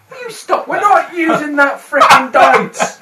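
loud exasperated protest; angry shout. Real, not acted. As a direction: yell it in protest. Player protests the GM's dice.

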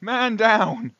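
urgent yell for a medic; man down shout. Pretend. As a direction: shout it across the battlefield. Calling for help in game.

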